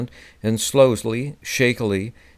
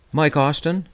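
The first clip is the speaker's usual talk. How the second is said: higher, rising question on the name; asking to be sure; 6 semitones higher than usual. A narrator's reading. Writer checks the old golfer's name, questioning rise. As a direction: check the name with a clear lift at the end. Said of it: "I asked just to be sure".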